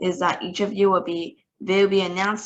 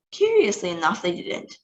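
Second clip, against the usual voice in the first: ordinary delivery, nothing marked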